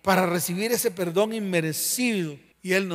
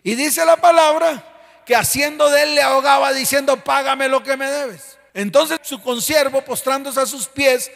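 loud, driving sermon at full force; fiery preaching; 2 semitones higher, 8 dB louder than usual. Acted. Pastor thunders at the church about forgiveness, loud preaching. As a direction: preach it hard to the congregation.

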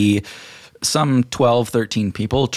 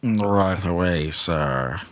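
obedient servant; 3 dB quieter, much slower than usual. Acted. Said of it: "and they just go".

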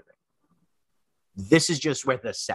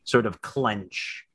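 slow, deliberate scene-setting with long pauses; game master narration. A narrator's reading. Game master describing a fighter stepping out, suspenseful narration. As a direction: build suspense phrase by phrase.

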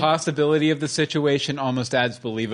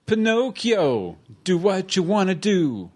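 slow, higher mock voice-over; 6 semitones higher, slower than usual. Dubbing a character's lines.

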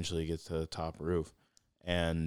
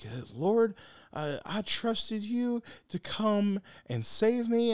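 high, slow, drawn-out plea; pleading to God; 3 dB louder, much slower than usual. Retold or imitated. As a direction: whine up at heaven slowly. Drowned man pleads to God.

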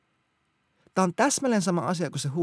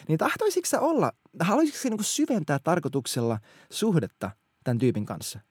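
ordinary delivery

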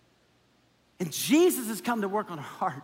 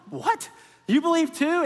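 incredulous council; 5 semitones higher than usual. Retold or imitated. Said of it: "And they're like".

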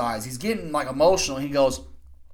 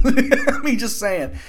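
high-pitched laughing aside; 7 semitones higher, 6 dB louder, slower than usual. Real, not acted. Wrestling fan joking about Paul Heyman, laughing. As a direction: laugh through the words, pitched up.

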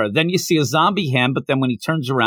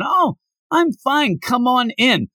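breezy reassurance; 9 semitones higher than usual. Acted. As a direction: wave off the worry in a higher voice. Voicing Hayato insisting all is fine.

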